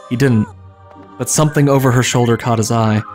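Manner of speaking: ordinary talk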